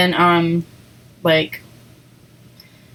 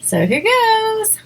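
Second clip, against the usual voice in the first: eager build-up; 12 semitones higher, slower than usual. Real, not acted. About to eat wasabi.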